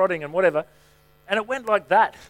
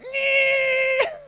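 drill noise. Retold or imitated. Imitating the dentist's drill noise.

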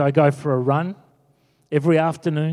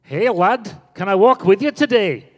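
higher, lilting mock Scottish accent; 5 semitones higher, 5 dB louder than usual. Old fella greeting preacher from behind, Scottish accent. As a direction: call out in a put-on Scottish brogue. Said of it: "I heard this Scottish voice behind me".

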